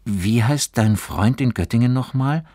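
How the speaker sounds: suddenly curious, pitch up; abrupt question. A narrator's reading. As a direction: ask it out of the blue. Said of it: "fragte er unvermittelt".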